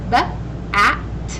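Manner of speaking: B-at-s split and said loud; segmented. Shown as a demonstration. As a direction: chop bats into b, at, s. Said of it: "The next word is bats".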